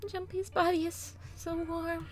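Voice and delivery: soft breathy voice. Acted. June voiced softly, clinging.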